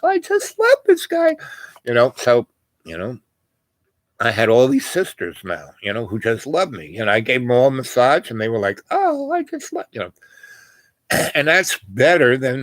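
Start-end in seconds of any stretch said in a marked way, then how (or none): 0.0-1.4 mock high gushing girl voice. Quoting the girls who adore him, in a high mock gush. 8.9-9.9 mock high gushing voice; gushing girl. Quoting the girls after the massage, in a high mock coo.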